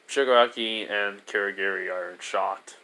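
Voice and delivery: normal talking voice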